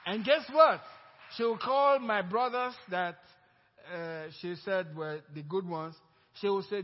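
loud, high, fervent preaching; preaching at full force. Real, not acted. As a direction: preach it out to the congregation. Preaching climax of testimony.

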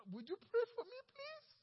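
very high, pleading plea; a worried coworker asking the believer to pray for him, rising high; pleading request. Acted.

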